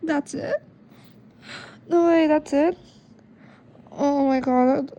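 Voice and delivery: loud stunned "that's it?"; can't believe the ending just stopped; shocked disbelief. Real, not acted.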